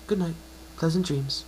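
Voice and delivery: high, bright-voiced mare, encouraging; a kind unicorn reassuring her patient; Twilight's voice.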